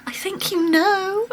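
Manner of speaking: high, slow and teasing; drawn out with a knowing grin; coy hint. Retold or imitated. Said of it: "And I just went".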